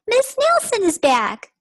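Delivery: put-on high, loud kid voice; the class greeting their teacher; excited children. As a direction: chirp it like happy schoolkids. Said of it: "said the class".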